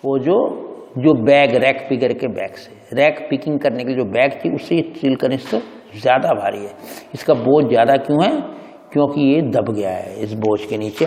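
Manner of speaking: ordinary talk, nothing marked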